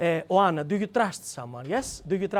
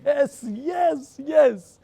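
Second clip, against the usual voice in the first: eager yes; 8 semitones higher, 4 dB louder, much slower than usual. Retold or imitated. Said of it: "And I say".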